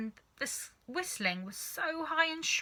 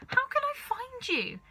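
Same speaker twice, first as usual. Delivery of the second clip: anxious calling. A narrator's reading. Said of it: "But she calmed down quite quickly".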